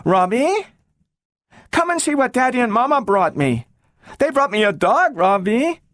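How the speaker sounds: put-on girl calling Robbie from the basement; shouting girl. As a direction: shout down the stairs. Shouting as she went.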